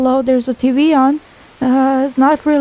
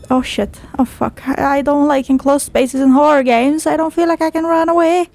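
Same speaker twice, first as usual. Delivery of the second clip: ordinary talk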